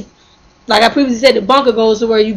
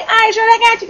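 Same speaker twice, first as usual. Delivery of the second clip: agreeable; 9 semitones higher than usual. Retold or imitated. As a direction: retell an eager yes in a high voice. Agreeable reply to favored coworker.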